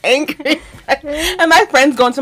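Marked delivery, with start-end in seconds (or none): 0.1-0.6 high, giggly words after a rant; laughter running through the words; laughing. Real, not acted. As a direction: say it while cracking up. Cracks up over own rant.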